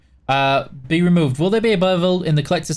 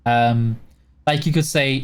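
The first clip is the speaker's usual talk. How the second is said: usual speaking voice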